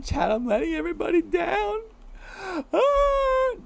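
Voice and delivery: high, squeaky hammed-up despair; mock wailing. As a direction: wail it thinly, rising into a mock cry. Mock wail of letting chat down.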